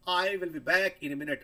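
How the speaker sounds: "I will be back in a minute" said slowly, pitch dropping at "minute"; slow falling demo. Shown as a demonstration. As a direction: let the voice fall at the end, slowly. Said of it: "एक sentence आपके सामने".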